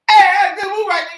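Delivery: high, loud, quick coach's shout; approving coach. Retold or imitated. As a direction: imitate an impressed coach calling out praise. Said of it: "he's like".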